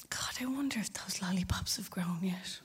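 soft, sly aside whispered from the corner of her mouth; a conspiratorial whisper dropped to the kids; coy sly whisper. Retold or imitated. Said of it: "as coy and as sly as anything".

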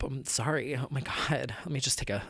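hushed, breathy apology; catching breath after an outburst; calming down. Real, not acted. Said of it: "whew, sorry".

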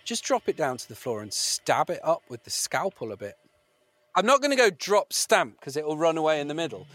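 ordinary talk

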